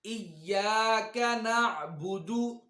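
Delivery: slow, melodic tajweed recitation, drawn out; slow Quranic recitation. Shown as a demonstration. Teacher reciting slowly for students to follow. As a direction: recite each word slowly for students to repeat.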